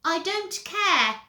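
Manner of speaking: put-on high, loud child voice; whiny little brother. Peter catching Henry in his room, high whine. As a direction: pipe up in a high brat voice.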